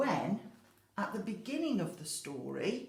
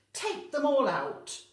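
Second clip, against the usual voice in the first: put-on wise old man; 6 semitones higher, 9 dB louder than usual. Wise old man's advice.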